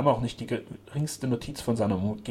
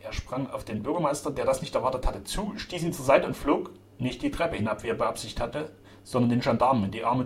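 usual speaking voice

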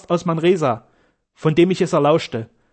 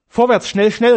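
higher, louder hurried cry; urgent call; 6 semitones higher, 6 dB louder than usual. A narrator's reading. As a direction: call them onward urgently. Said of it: "rief die Gräfin". (drängend gerufen)

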